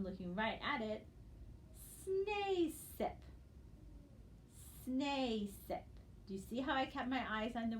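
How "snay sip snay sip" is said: "snay sip" read slowly syllable by syllable; blended reading. Shown as a demonstration. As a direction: sound out "snay sip" clearly for the class. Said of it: "with our eyes down".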